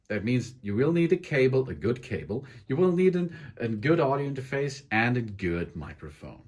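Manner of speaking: ordinary talk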